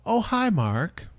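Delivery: movie catchphrase quote. Acted. Podcaster quoting The Room to co-hosts, hammy movie impression. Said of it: "every once in a while go".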